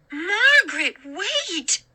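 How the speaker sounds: high, frightened mother's call; the mother calling out from the doorway; mother crying out. A narrator's reading. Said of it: "her mother cried".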